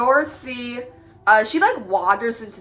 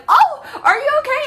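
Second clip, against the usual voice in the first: Dorothy voice; 4 dB louder than usual. Retold or imitated.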